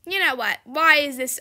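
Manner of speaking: usual speaking voice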